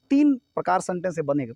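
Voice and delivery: usual speaking voice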